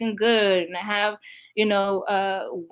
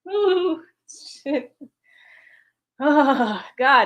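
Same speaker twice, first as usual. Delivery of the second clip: shaken up; 5 semitones higher, much slower than usual. Real, not acted. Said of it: "I just got triggered".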